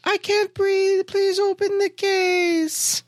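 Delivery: put-on high, slow eerie child; creepy little kid pleading slowly. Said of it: "creepy little kid voice".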